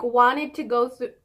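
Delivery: ordinary talk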